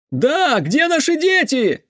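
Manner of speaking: high, drawn-out shout; crowd shout. A narrator's reading. Another parent joins in the demand, shouting. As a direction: yell it out from the crowd. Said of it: "закричал ещё кто-то".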